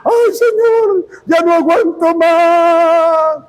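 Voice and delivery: high, slow, hammed-up moaning worship leader; mock whiny singer. Mocking the song leader's depressive songs, whiny and high. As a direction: wail it like a defeated song leader. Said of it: "Cuatro canciones depresivas".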